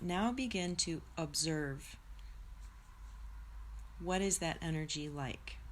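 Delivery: soft, unhurried meditation guide; guided meditation. Acted. Teacher guides her live viewers through a soft meditation. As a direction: ease listeners inward, gently.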